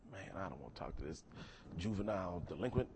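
hushed, unvoiced aside; whispered aside. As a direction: whisper it to yourself. Inner thought, whispered aside.

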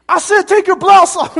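high, loud barked order; drill instructor yell. Retold or imitated. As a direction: bark it like an angry drill sergeant.

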